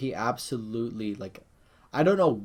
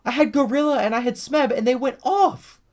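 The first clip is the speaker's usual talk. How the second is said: much higher and louder, gushing; bursting with glee over his picks; excited; 13 semitones higher, 5 dB louder than usual. Real, not acted. Fan bragging about his fantasy picks, excited and much higher.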